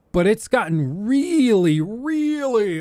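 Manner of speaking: real voice breaking mid-word; squeaky break on really; voice crack. Real, not acted. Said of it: "that was my, my Dutch voice crack".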